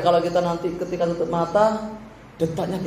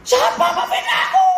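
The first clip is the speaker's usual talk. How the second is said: much higher, indignant mock shout; shouting back; 4 dB louder than usual. Preacher acting the flesh screaming at slander, high mock shout. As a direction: yell it like someone slandered. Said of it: "Langsung teriak".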